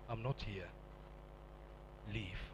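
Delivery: low, soft divine voice; quiet voice of God. Retold or imitated. God telling him to leave the cult, low and soft. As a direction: speak it softly, low and still. Holy Spirit speaking.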